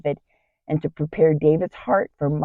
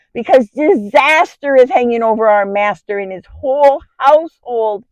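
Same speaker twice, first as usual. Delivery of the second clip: urgent, higher-pitched report of bad news; alarmed shepherd; 4 semitones higher, 4 dB louder than usual. Shepherd brings his master's wife news of looming disaster, alarmed. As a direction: voice an agitated servant pleading for action. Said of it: "came running to me with alarming news".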